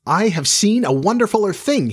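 put-on high, wonder-filled boy's voice; excited cry. A narrator's reading. Boy tells gathered friends of a marvel, high excited cry. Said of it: "he cried".

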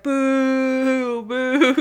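sustained mock booing; imitated crowd booing. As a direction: boo like a jeering crowd. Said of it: "and then everyone's like".

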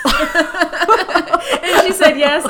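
slow, incredulous laugh through the line; laughing disbelief. Real, not acted. Disbelief at the bride.

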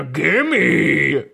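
drawn-out mock growl of a demand; stretched, put-on creature voice; monster's gimme. Shown as a demonstration. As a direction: ham up a greedy monster demand. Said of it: "that doesn't sound like anything".

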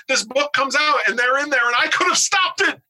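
high-pitched, hammed-up despair; mock anguished regret. Confessing his dread of uncaught typos as Schindler's List tragedy, mock anguished. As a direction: wail it like an overwrought movie climax. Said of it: "this is my Schindler's List moment".

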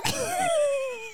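squealing laugh; high helpless laugh. Real, not acted. Remembering the stranger's face, high squealing laugh. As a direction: let the laugh break out high. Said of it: "real laughter".

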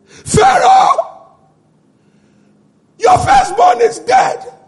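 high, loud scream of alarm. Acted. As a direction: cry out the dreadful news. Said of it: "he screamed".

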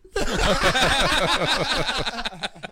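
long loud laughter. Real, not acted. Laughing at the Uber receipts joke, loud laugh. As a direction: crack up loudly.

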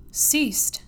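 high, loud burst of eager surprise; eager exclamation. A narrator's reading. Stranded traveller hears the snow has stopped, eager exclamation. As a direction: leap on the word with sudden hope. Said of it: "I exclaimed, starting eagerly".

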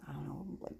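breathy whispered aside, unsure of the name; hushed aside mid-story. Real, not acted. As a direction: drop to a whisper as if to yourself. Aside while recalling a name.

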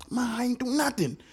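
lightly voiced whining boy; character voice. Shown as a demonstration. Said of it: "let me catch his voice".

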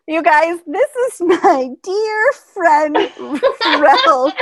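mock the old lady's proud introduction; imitating grandma. Grandma introducing her friend on the family call, hammed-up old voice. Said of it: "over and over again".

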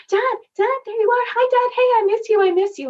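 overjoyed high-pitched greeting to her dead dad. Retold or imitated. Daughter greeting her late dad, excited and high.

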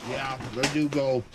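eating while talking; talking through a mouthful; mouth full. Real, not acted. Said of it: "Sorry, I'm eating dinner while we're doing this".

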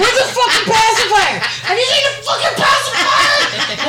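high, loud escalating yell; frantic searching. Retold or imitated. Parent hunting a lost pacifier at night, shrieking. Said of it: "running around the room going".

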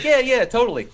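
high, loud mock-eager yes; eager agreement. Victim agreeing to the Wishmaster, mock eager.

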